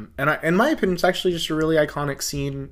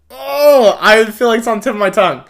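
frustrated recall; 11 semitones higher, 14 dB louder than usual. Real, not acted. Straining to recall a quote.

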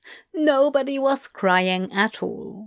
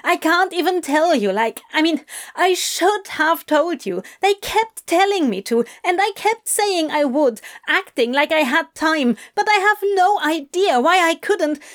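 high-pitched, rushing, flustered outburst; furious, lost for words; 7 semitones higher than usual. Acted. Adam failing to explain himself to Crowley, furious and flustered. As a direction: spill it out high and frustrated. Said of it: "furious that he didn't have the words".

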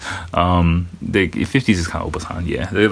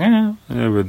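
mock shrug; 11 semitones higher than usual. Japanese people shrugging off quake.

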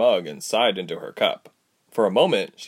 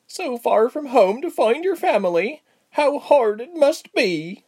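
much higher, slow, emotional; Pia moved; 3 dB louder than usual. A narrator's reading. Pia pitying the travellers, high and emotional. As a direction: overcome with sympathy.

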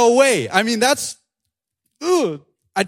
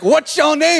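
high singsong mock question; comically high, chanted demand; TikTok-style Jesus; 9 semitones higher, 3 dB louder than usual. Jesus questioning the demon, high singsong TikTok imitation. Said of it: "Jesus is just like".

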